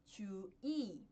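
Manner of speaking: 주의 said slowly and carefully as ju-i; Korean word said slowly. Shown as a demonstration. Teacher to students, demonstrating 주의 slowly. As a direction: spell out 주의 syllable by syllable, ju then i. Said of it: "Ao invés de pronunciar 주의".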